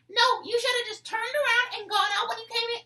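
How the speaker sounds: very high, loud indignant mock teacher; teen imitating the teacher scolding him, high and loud; teacher screaming. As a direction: demand it like an offended teacher. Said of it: "she keeps on screaming at me".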